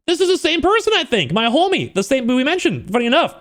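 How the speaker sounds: high-pitched, eager exclaiming; excited recognition. Retold or imitated. Spotting the same person we mentioned, excited high voice. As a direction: pitch it way up, thrilled to know them. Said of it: "So I got excited from that".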